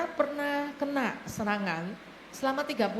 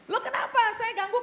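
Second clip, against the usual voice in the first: laughing retort; 10 semitones higher, 3 dB louder, faster than usual. Retold or imitated.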